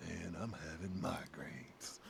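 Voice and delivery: put-on deadpan it like a sleepy kid; Billy's deep voice. Billy explaining his scar to friends, slow mellow deadpan. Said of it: "he had that deep voice".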